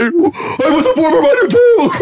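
very high, loud comic wail; mock outrage. Streamer replaying his comeback to the critic, mock outrage. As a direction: cry it out in mock horror, laughing.

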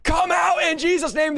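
high, forceful exorcist's shout; yelled command. Shown as a demonstration. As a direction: yell it like you are ordering a demon out. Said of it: "whether you yell".

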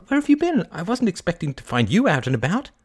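high-pitched, delighted surprise; surprised greeting. A narrator's reading. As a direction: greet them as an unexpected find. Kay greets Jonathan happily.

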